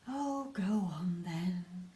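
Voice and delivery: slow, sighing give-in; sighed. Acted. Mum giving in to Lester with a slow sigh. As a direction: let it out on a tired sigh. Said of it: "sighed Missus Large".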